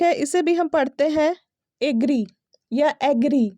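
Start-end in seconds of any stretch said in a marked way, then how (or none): 1.8-2.3 एग्री opening on a long "ay"; wrong pronunciation. Shown as a demonstration. Teacher showing a silly mistake, wrong pronunciation. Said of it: "completely गलत है". 3.0-3.5 एग्री opening on an open "a" as in cat; wrong pronunciation. Shown as a demonstration. Teacher showing a second mistake, wrong pronunciation. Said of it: "completely गलत है".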